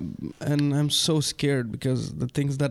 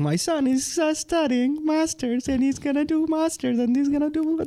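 boastful parent voice, pitched way up; proud parent imitation; 10 semitones higher than usual. Imagining the parents' thoughts about their son, proud and high-pitched. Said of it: "They are, like, thinking".

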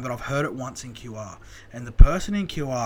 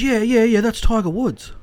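higher and louder, imitating wife's excitement; mock excited wife; 10 semitones higher, 11 dB louder than usual.

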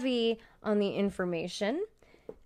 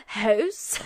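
laughing house; 13 dB louder than usual. Shown as a demonstration. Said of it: "Which is super embarrassing".

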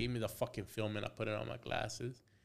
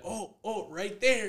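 higher-pitched mock discovery of the spot; imitated eager therapist; 8 semitones higher, 4 dB louder, slower than usual. Therapist digging into patient's back, imitated eager call. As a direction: lightly act the therapist zeroing in.

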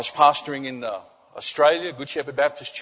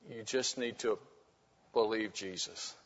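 soft closing appeal; 4 semitones lower, 10 dB quieter than usual. Acted.

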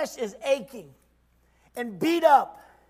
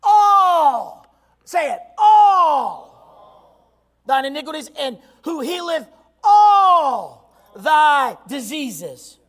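slow, loud crying shout; preaching cry; 8 dB louder, much slower than usual. Acted. Preacher rereading the psalm in a loud, slow cry. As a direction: wail it out, dragging each word. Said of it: "Let me say that again".

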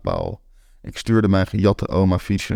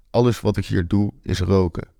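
usual speaking voice

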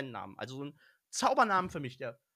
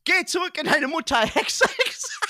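mock witch spell; 9 semitones higher, 6 dB louder than usual.

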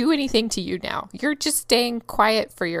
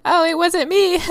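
innocent denial; 7 semitones higher, 6 dB louder than usual. Retold or imitated. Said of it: "I was like".

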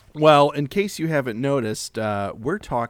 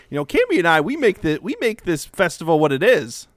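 raised, heated protest; indignant outburst; 5 semitones higher, 5 dB louder than usual. Acted. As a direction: insist hotly on your worth. Skit argument, indignant.

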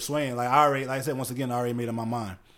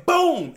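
mock boom; 12 semitones higher, 6 dB louder than usual. Acting out the headbutt again.

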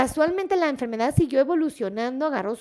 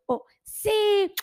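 high, gleeful "sí"; delighted cheer; 8 semitones higher than usual. Real, not acted. Host celebrating Fabiola's share, delighted cheer. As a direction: cap the chant with a high-pitched cheer.

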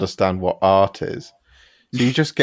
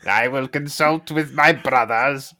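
lightly voiced cartoon villain sneer; a hint of cheap Skeletor menace; discount Skeletor; 5 semitones higher than usual. Friend lightly mocks audiobook villain voice as cheap Skeletor. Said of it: "he is like a sort of discount Skeletor".

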